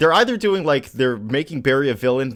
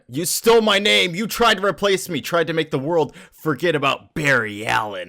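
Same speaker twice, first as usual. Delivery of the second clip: mad outburst; 6 semitones higher than usual. Retold or imitated. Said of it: "he's just super mad".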